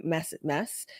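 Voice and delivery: short Boston-accent demo on "mass"; Boston vowel, mouth corners up. Shown as a demonstration. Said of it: "put the corners up to say Boston accent".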